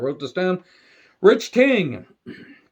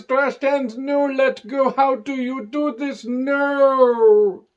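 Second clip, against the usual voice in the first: high, drawn-out hammed-up wail; mock anguish; 7 semitones higher, much slower than usual. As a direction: play-act crushed hands, stretching the final word. Acts out psychic having her hands crushed.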